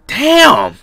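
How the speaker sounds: sudden loud shout of frustration. Real, not acted. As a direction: yell it out, caught off guard. Sudden outburst during game.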